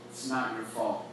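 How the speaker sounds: softly steady, a touch of tenderness; quiet and firm, looking him in the eye; tender, resolute. Retold or imitated. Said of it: "full of love, full of tenderness".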